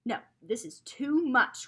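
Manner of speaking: loud, slow angry outcry; angry call. A narrator's reading. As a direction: cry it out slowly in anger. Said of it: "Grandfather called angrily".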